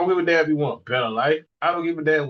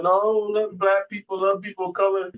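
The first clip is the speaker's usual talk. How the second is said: a hint of a gushing do-gooder, slowed down; 6 semitones higher than usual.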